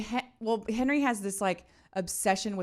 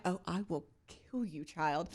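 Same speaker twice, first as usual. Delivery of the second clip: a light, quiet hint of a menacing mother; softly murmured mock threat; mock evil mom; 3 semitones lower, 9 dB quieter than usual. Mother turning into evil mom at her son, quietly menacing. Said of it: "And I was like".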